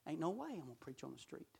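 low, very soft, quick refusal; reluctant muttering. Retold or imitated. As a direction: mutter it privately, as if to yourself. Acting out his own reluctance.

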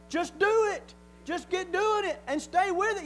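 loud, high, emphatic order; God commanding. Acted. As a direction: drive the command home like a preacher. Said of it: "and he said".

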